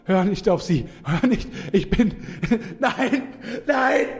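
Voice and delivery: frantic pleading. Acted. Frantic warning to twin.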